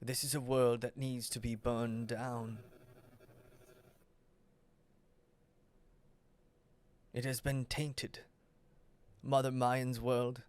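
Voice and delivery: very soft menace, long pauses between phrases; quiet demonic villain. Acted. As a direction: pass judgement in a hushed, deliberate voice. GM voices Vrag condemning the world.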